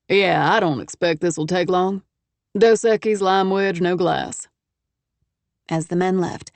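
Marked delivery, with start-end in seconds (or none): 0.1-4.5 a hint of a confident Texas drawl, easy and sure; lightly voiced Texan businessman. A narrator's reading. Travis sending his associates off, lightly voiced Texan. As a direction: play the self-assured Texan host, lightly.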